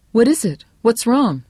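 high, loud, urgent questions; alarmed wife. Acted. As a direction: demand answers in rising alarm. Wife sees husband's shocked face.

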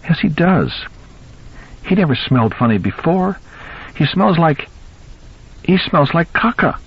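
put-on high-pitched upset child voice; distressed. A narrator's reading. Daughter telling Daddy how the cat smells, distressed. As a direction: complain like a distressed little girl, high. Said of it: "Ellie said, distressed".

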